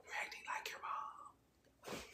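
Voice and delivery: breathy unvoiced whisper; hushed confiding aside. Real, not acted. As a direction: lean in and whisper it.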